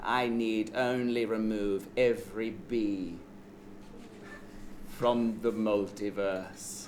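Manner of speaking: low, slow menacing villain. Acted. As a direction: deliver it like a cold tyrant. Villain monologue to heroes.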